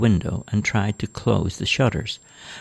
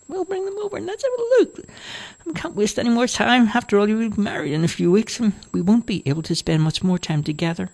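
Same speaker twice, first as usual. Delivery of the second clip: put-on high, whiny old woman; a fussy mother grumbles about the noise, high; complaining old mother; 14 semitones higher, faster than usual. Mother urges daughter.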